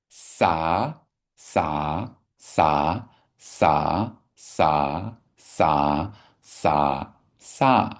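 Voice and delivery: "Sa" said eight times evenly; repeated syllable drill. Shown as a demonstration. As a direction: drill "sa" in a steady row. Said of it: "I got a eight".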